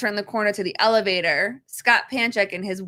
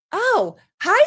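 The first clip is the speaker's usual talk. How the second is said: put-on much higher, louder child greeting; little boy voice; 12 semitones higher, 5 dB louder than usual. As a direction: chirp it like a shy little boy. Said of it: "And he goes".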